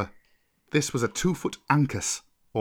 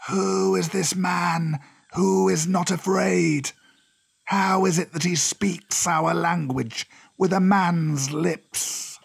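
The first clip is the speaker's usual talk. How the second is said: slow, higher-pitched serpent voice; imperious, drawn-out cobra questioning; 5 semitones higher, slower than usual. Acted. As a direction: draw out each word as a wary old cobra. Cobra figure in the story.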